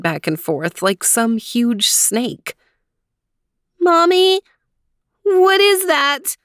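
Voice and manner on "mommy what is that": put-on high, slow frightened child; a scared little girl asking. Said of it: "asked Leanie in a frightened voice".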